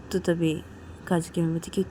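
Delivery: ordinary delivery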